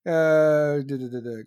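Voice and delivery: filler while searching. Real, not acted. Scanning for his article.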